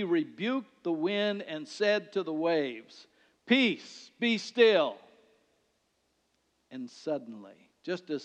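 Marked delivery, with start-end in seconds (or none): 3.5-5.0 loud, raised command to the storm; commanding rebuke. Acted. Jesus commanding the wind and waves, raised and loud. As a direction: rebuke the wind with full authority. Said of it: "the word rebuke there literally means to raise your voice and command".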